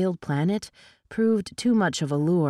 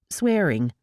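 plain reading voice

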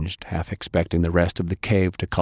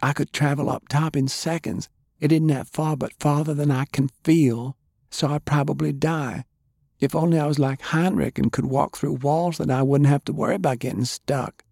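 put-on young girl, hushed; 8 semitones higher than usual. A narrator's reading. Girl voiced in story.

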